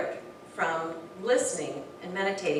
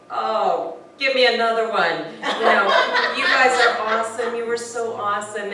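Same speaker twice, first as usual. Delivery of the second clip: louder, laughing gush of praise; gushing with laughter; 3 semitones higher, 10 dB louder than usual. Retold or imitated. Praising firefighters, loud and laughing. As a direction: laugh and gush over them. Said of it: "And I'm like".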